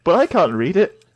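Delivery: joking cliffhanger outburst; mock exclaiming Taichi. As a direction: blurt it out as an imagined character. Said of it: "Ty's like".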